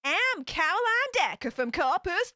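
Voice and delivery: high, swooping attempt at a Texan accent; attempted American accent. Shown as a demonstration. Vlogger quotes Annie's fake-ID line from Community, attempted Texan accent. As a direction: have a game go at a Texas accent. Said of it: "this is [name]'s American accent".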